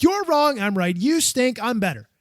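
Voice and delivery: mock argument. Two listeners arguing over Lego, mock bickering. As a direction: trade playful jabs, punching the accusations. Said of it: "you're having an argument over Lego".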